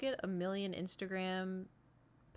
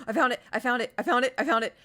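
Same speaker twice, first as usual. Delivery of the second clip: louder, repeated triumphant cry; gasping with delight; excited discovery; 5 semitones higher, 11 dB louder, faster than usual. Real, not acted. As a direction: shout it out, thrilled you found it.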